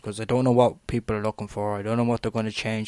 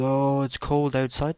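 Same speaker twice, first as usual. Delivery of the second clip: mock pity; 3 semitones higher, slower than usual. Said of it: "these people".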